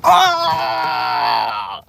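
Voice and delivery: drawn-out mock cry of distress; staged yell. Shopper fakes a scene as a distraction, long staged yell. Demonstrates causing a scene in store.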